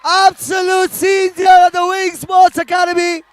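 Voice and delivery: high, loud and slowed, savouring the moment; drawn-out excited call over the noise of the crowd; thrilled commentary. Real, not acted. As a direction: ride the wave of the equaliser. Aftermath of the equaliser.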